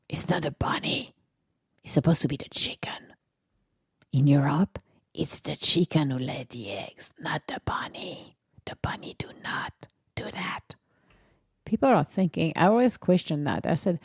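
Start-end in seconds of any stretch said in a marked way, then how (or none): 0.1-10.8 whisper. Acted. Whispered aside about the bunny.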